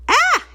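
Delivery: sharp high scream of fright; startled scream. Acted. Cadance screaming as the sorceress appears, high scream. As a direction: cry out in sudden fright. Sudden scare in the story.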